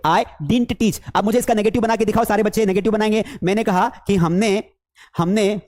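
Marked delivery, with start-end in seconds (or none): 0.1-1.0 didn't as one syllable DINT; correct pronunciation. Shown as a demonstration. Teacher repeats example for class, didn't said DINT. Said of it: "क्या बोलेंगे?".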